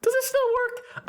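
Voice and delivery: high, slow announcer voice; a drawn-out show title; announcer title read. Acted. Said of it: "show title read".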